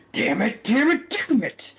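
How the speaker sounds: villain throwing parts against the wall, cursing loudly; frustrated cursing. Acted. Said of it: "machine parts getting thrown everywhere".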